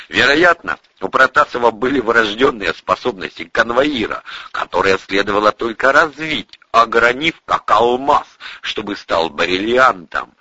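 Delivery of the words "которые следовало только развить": ordinary delivery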